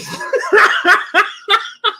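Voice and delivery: loud, high burst of laughter; big high-pitched cackling laugh; real laughter. Real, not acted. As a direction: crack up at your own story. Cracks up at own story.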